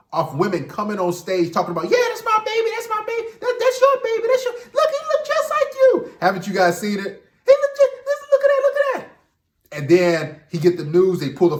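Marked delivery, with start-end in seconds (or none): 1.8-6.1 much higher put-on woman's voice, insisting; high mock woman claiming the baby, half laughing; talk show woman. 7.5-9.8 much higher put-on woman's voice, insisting; high mock woman claiming the baby, half laughing; talk show woman.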